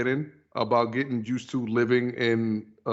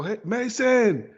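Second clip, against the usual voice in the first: higher, drawn-out parental call; 9 semitones higher, 3 dB louder, slower than usual. Shown as a demonstration. Father calling his son Mason, raised drawn-out call. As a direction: summon a child from another room, slowly.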